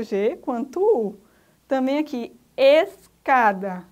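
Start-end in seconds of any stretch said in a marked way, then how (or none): 2.6-3.8 escada said slowly and loud, s and c both sounded; slow loud teaching escada; careful pronunciation. Shown as a demonstration. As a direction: stretch escada so every letter is heard. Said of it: "Pronunciamos tanto S quanto C".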